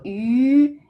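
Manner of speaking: YU held long and loud, lips rounded; rounded vowel demo. Shown as a demonstration. Teacher modelling a sound English lacks, drawn-out YU. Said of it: "when you pronounce yu".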